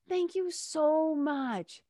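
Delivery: high, very slow, imitated gratitude; heartfelt thanks. Shop girl to helpful customer, heartfelt thanks. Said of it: "You can just hear it in her voice".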